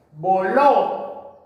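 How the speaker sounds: voló sounded out, weight on LÓ; vo-LÓ, held on the ending; stress on last syllable. Shown as a demonstration. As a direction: lean hard on the final ó of voló. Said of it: "termina en vocal y es aguda".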